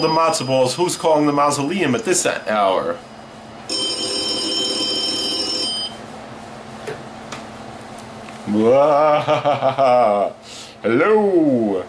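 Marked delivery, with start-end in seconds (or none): 3.0-10.8 long laugh. Acted. Host laughs on the phone.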